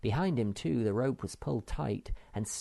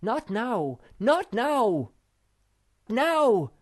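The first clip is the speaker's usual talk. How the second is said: rising louder and higher to a final shout; building command; 13 semitones higher, 8 dB louder, much slower than usual. A narrator's reading. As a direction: hold back, then yell the go. Timing a rope pull.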